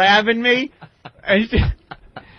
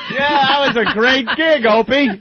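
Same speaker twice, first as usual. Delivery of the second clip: higher, mock-cheerful sarcasm; sarcastic; 7 semitones higher than usual. Comic mocks his partner after the gig, sarcastic. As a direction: fake praise dripping with irony.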